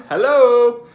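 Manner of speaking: high, loud mock greeting; playful hello. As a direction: sing out a cheeky hello. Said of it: "Don't know why I'm saying hello".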